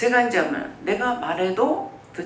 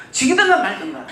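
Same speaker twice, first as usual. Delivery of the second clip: retold angry outburst; 4 semitones higher, 6 dB louder than usual. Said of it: "막 화를 내요".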